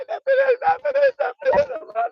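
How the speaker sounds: high, loud crying wail; wailing shout. Pretend. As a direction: wail it out like a loss. Wailing cry over lost fortune.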